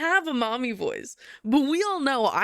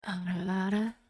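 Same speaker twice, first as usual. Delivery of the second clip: softer, lower mommy voice; 4 semitones lower, 4 dB quieter than usual. Pretend. Streamer channels a soft mommy voice for chat. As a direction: coo it softly like a doting mother. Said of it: "I have to channel the mommy voice for it".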